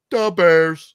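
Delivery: loud hammed-up Chicago sports fan; Chicago fan cheer. Chicago fan in the background cheering his team, loud hammed-up Chicago accent. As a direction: shout it like a Bears fan in a crowd. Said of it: "in the background going".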